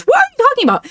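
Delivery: high, disbelieving question; can't believe what she just said; exasperated, high and disbelieving; incredulous. Real, not acted. Incredulous reaction to streamer.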